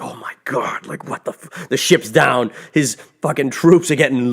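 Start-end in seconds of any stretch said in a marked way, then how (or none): 0.0-1.7 hushed, half-whispered mock disbelief; stunned mutter. Thanos watches his ship destroyed, acted stunned hush. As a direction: let the voice drop away, cut off mid-curse.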